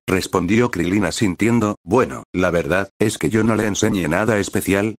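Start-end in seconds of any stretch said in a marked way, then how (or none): none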